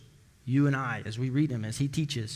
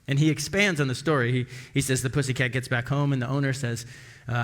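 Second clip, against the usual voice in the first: ordinary talk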